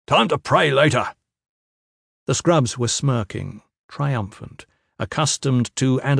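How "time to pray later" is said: raised-voice trainer's order; brisk bark to a trainee, pitched up. A narrator's reading. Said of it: "Trainer Fisk barked".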